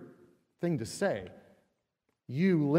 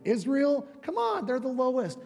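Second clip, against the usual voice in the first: much higher, excited crowing; gleeful boast; 10 semitones higher than usual. Retold or imitated. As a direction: brag to everyone watching. God boasting about lowly Israel.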